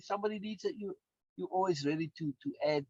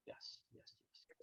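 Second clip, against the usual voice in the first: barely voiced, under the breath; soft whispered agreement; muttered yeses; 25 dB quieter than usual. Real, not acted. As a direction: mutter it to yourself.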